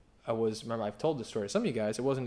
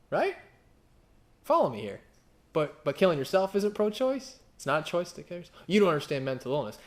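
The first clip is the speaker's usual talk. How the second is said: retold challenge; 5 semitones higher, 5 dB louder than usual. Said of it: "A pastor friend of mine said".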